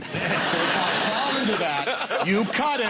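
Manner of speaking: lightly posh, mock royal voice, pitched high; half-hearted Queen impression, laughing; slipping into the Queen. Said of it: "I'm not gonna do an imitation of the Queen".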